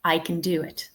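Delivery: connected "I can do it", no weight on "can"; everyday unstressed "can"; natural weak form. Shown as a demonstration. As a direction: link the words smoothly, reduce "can" to "kun". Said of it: "In real life, I would say".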